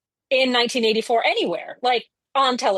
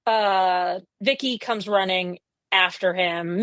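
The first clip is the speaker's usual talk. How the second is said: ordinary delivery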